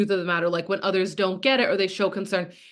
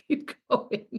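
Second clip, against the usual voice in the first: laughing; 8 dB quieter than usual. Real, not acted. Laughing at her own teaser.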